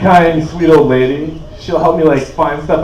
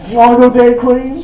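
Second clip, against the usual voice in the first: comforting an upset pregnant ex with a touch of laughter; mock soothing; 9 semitones higher than usual. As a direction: coax her like a child, laughing. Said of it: "I'm like".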